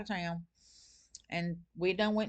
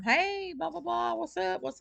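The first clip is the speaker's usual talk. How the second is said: cheery client greeting, pitched much higher; imitating the client; 10 semitones higher, slower than usual. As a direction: play the caller breezing in with a high hey.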